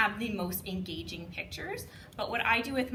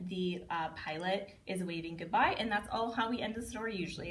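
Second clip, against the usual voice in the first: ordinary delivery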